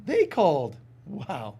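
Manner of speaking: slow, drawn-out mock surprise; sarcastic disbelief. Listener mocking the caller, slow sarcastic wow. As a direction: drag out the fake surprise. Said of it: "that was a moment of".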